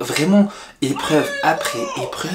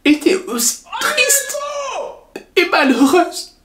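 high, breaking sad voice; tearful; 11 semitones higher than usual. Acted. Wife breaks down.

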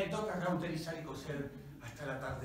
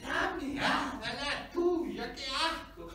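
high-pitched acted disgust; disgusted protest; 9 semitones higher, 4 dB louder, much slower than usual. Companions told to swallow urine, disgusted protest.

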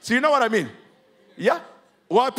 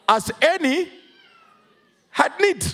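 full-force preaching; 8 semitones higher, slower than usual. Acted. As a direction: drive the verse home to the congregation. Preaching peak.

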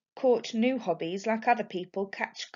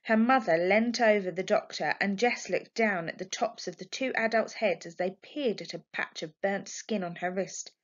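plain reading voice

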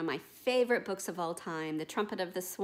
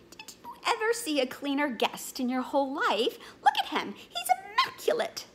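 loud, rising ever higher in outrage; outraged boatman; 8 semitones higher, 5 dB louder than usual. Acted. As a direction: build to a high indignant peak. Boatman defends the swan.

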